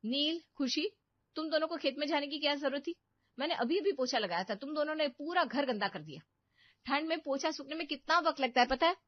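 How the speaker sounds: put-on loud, high, fast scolding of the kids; mother scolds children for muddy shoes, angry and loud. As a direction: tear into them for dirtying the floor.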